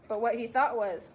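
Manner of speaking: plain reading voice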